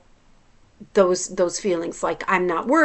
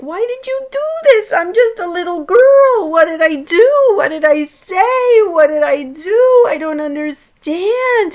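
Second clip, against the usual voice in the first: put-on high, loud, sobbing little-girl pleas; hysterical crying; 10 semitones higher, 8 dB louder than usual. Reliving the punished first-grader, crying to the nun in a high, loud sob. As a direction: wail it like an abandoned child in tears. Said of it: "I'm alone and I'm hysterical crying".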